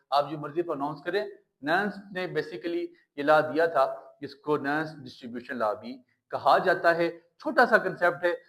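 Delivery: ordinary delivery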